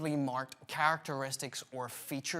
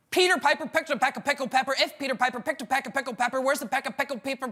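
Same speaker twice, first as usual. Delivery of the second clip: rattled off even faster; quicker tongue twister; 6 semitones higher, 5 dB louder, much faster than usual. Shown as a demonstration. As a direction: rush it as fast as you can. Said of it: "And quicker!".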